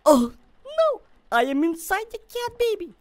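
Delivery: high-pitched mock alarm; playful panic. Player finds he is inside the cat, playful panic. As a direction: squeal it in exaggerated panic.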